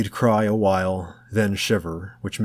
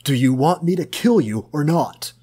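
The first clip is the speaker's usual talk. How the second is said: louder, higher furious demand; angry shout; 6 semitones higher, 5 dB louder than usual. A narrator's reading. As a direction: threaten him at full voice. Tom furious at George.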